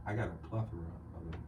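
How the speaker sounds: low, soft, put-on smooth deep voice; mock-suave deep voice; Denzel Washington. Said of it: "stop with this Denzel Washington voice".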